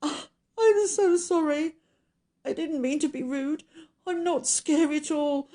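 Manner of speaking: high-pitched, wailing apology; hammed-up weepy, lonely monster; tearful creature. A narrator's reading. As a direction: plead pitifully in a high crying voice. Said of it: "with tears rolling down his cheeks".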